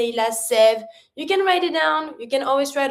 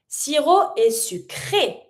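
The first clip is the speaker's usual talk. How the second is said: usual speaking voice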